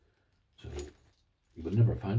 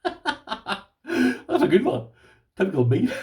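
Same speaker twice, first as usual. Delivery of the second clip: laughing; 12 semitones higher, 6 dB louder, much slower than usual. Real, not acted. Laughing at his own T-shirt joke.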